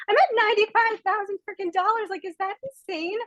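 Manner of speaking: giddy, amazed brag; thrilled. Real, not acted. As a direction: share the big number like you can't believe it. Announcing her earnings, thrilled.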